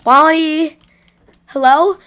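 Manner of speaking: loud calling out; shouting. Acted. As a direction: yell for a lost friend. Calling for missing friend.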